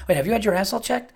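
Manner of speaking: high, fast, earnest imitated question; hammed-up serious friend; utmost sincerity. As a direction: ask it with total sincerity. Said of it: "with the utmost sincerity".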